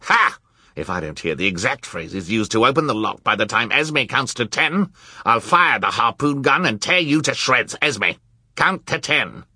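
loud threatening villain; menacing villain. A narrator's reading. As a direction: bark out the threat. Olaf threatens with harpoon.

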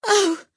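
sharp high anguished oh; a bird's anguished cry; despairing cry. A narrator's reading. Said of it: "her single "Oh!".

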